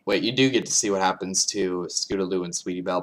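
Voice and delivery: ordinary talk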